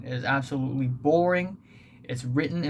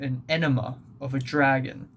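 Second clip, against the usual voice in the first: normal talking voice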